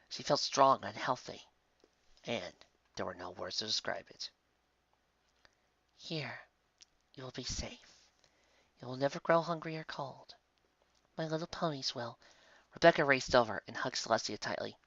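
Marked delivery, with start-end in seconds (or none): 6.0-12.2 soft, breathy kind angel; an angel soothing the girl in a whisper; gentle angel whisper. Acted. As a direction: whisper comfort to a dying child. Angel promises safety.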